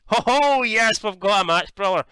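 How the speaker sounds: high, loud "ho ho" burst of glee; excited outburst. Real, not acted. Gamer cheering his match on the card, excited. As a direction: whoop it out, then settle into bravado.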